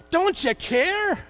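high, slow, drawn-out plea; slow despairing plea. Retold or imitated. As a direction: stretch it out in despair. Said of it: "it says in one of the Gospels".

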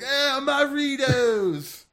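high drawn-out surfer yell; surfer dude shout. Acted. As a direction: yell it like a stoked beach bro. Surfer wrestler voiced in entrance.

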